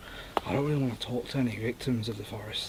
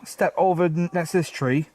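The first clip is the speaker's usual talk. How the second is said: raised, higher request; calling out; 5 semitones higher, 5 dB louder than usual. Real, not acted. Investigator directs the ghost next to a tree, calling out. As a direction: project it towards the unseen listener.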